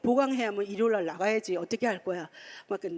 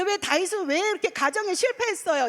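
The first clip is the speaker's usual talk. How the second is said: indignant question; 9 semitones higher, 5 dB louder than usual. Retold or imitated. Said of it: "이러면서 제가 막".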